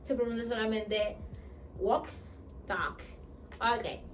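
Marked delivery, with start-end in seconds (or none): 1.8-3.0 "walk" and "talk" said as /wok/ and /tak/, with no L; silent L. Shown as a demonstration. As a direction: clip "walk" and "talk" with no L sound. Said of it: "no se pronuncia la N". ("walk" y "talk" sin pronunciar la L)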